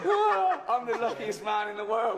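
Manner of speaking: very high, loud laughing gush; overjoyed laughing cry; delighted laughing. Real, not acted. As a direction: squeal with delight. [name] thrilled meeting puppet.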